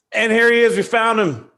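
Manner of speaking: high, loud guest reveal; excited welcome. Real, not acted. Host bringing on the guest he found, excited welcome. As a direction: announce the find with big excitement.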